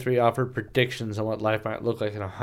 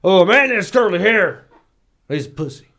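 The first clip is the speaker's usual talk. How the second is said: higher, louder sneering put-on voice; mocking bigot voice; 6 semitones higher, 5 dB louder than usual. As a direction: sneer it like a narrow-minded old-timer.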